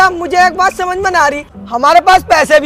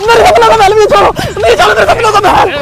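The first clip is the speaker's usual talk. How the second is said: higher-pitched hysterical repeating; frantic raving; 5 semitones higher, 6 dB louder than usual. Acted. Man dragged from his dream palace, frantic. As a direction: rave and struggle to get free.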